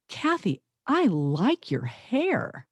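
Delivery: "Kathy" pitched high, then "I like your hair" very drawn out, falling; high-pitched "Kathy", then slow, weighted "I like your hair"; different emphasis. Shown as a demonstration. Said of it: "total different emphasis on the same words".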